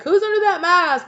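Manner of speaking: high, loud curious call; startled question to a masked friend; curious surprise. Pretend. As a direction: ask it in a bright surprised voice. Curious reaction.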